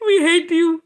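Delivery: high, loud line breaking into laughter; laughing mock demons. Voicing the demons' hate while laughing, high and loud. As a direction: squeal it out laughing. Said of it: "And I hear".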